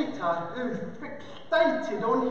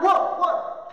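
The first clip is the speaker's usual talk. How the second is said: two raised woofs like a dog; imitated dog woofs; dog bark; 4 semitones higher, 3 dB louder than usual. Shown as a demonstration. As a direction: bark twice, pitched up. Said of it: "At least a dog barks".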